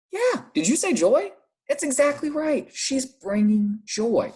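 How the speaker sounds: high, bright teacher praise; praising. Acted. Teacher praising a pupil's answer, high and delighted. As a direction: cheer the class on for the right answer.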